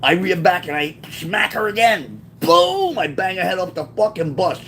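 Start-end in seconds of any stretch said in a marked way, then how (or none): 2.4-3.0 drawn-out high "boom"; imitated impact boom. Storyteller mimicking another smack, high exclaimed boom. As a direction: sound out the impact on a high note.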